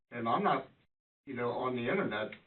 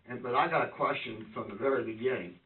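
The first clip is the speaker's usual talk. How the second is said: ordinary talk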